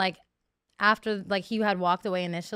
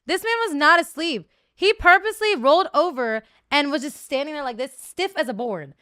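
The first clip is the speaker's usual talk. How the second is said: indignant outburst; 5 semitones higher, 7 dB louder than usual. Real, not acted. Indignant retelling.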